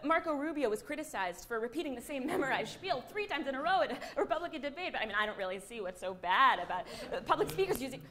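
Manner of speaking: rattled off quickly as if by rote; memorized spiel. Acted. News anchor repeating a criticized memorized spiel word for word, hurried and rote. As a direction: race through the same words without pausing.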